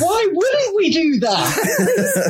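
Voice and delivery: high and very slow, laughing through it; giddy excitement. Real, not acted. To the doubtful co-host, giddy and slow with laughter. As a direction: stretch it out, delighted.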